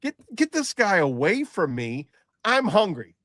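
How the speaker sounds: high, hammed-up complaint; mock your own selfish impatience; self-mocking callous demand.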